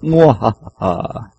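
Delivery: loud, slow villain voice with evil laugh; mad scientist. Pretend. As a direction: boom it out like a cackling mad villain. Show opens with doomsday villain voice.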